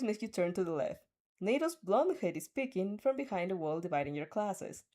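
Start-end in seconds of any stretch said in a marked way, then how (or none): none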